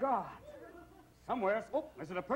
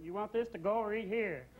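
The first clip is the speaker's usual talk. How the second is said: lightly voiced fast-food clerk; a hint of a counter clerk; 5 semitones higher than usual. Son working fast food asking a customer, lightly voiced. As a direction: ask it like a bored counter clerk.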